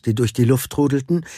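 ordinary delivery

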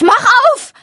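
high, loud desperate shriek; panicked shriek for Pa to open up. A narrator's reading. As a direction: shriek at the trapdoor. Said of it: "kreisch ich".

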